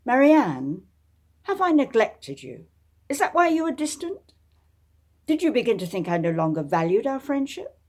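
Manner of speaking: put-on anxious young gentleman pleading; earnest Justin. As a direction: reach out to her, anxious to be heard.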